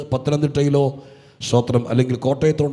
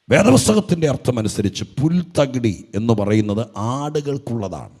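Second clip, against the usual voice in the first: ordinary delivery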